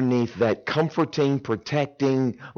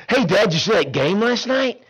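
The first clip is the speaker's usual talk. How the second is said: cheery son; 7 semitones higher than usual. Retold or imitated. Son calling dad casually.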